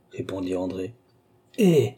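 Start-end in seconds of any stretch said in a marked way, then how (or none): 1.6-1.9 sudden high impatient exclamation. A narrator's reading. Father losing patience with his evasive daughter, impatient exclamation. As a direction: cut in with an impatient outburst. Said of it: "s'écria Tavernet impatienté".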